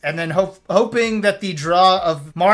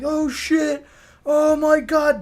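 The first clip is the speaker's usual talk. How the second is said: imitated high-pitched hype; excited fan reaction; 8 semitones higher, much slower than usual. Streamer imitating fans hyped for a Smash movie, high mock excitement.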